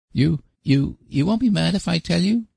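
put-on high, halting childish plea; imploring boy. A narrator's reading. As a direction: beg anxiously like a small boy. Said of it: "implored Davy".

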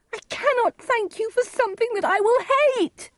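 very high, upset daughter voice; distressed defiance. Acted. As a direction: protest in a high wail. Juliet defies her angry father.

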